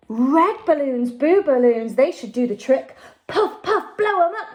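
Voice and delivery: usual speaking voice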